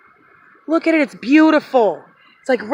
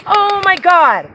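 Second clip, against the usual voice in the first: slow, stunned, loud oh my God; drawn out in disbelief; awestruck; 4 semitones higher, 8 dB louder, slower than usual. Real, not acted. Stunned by the find.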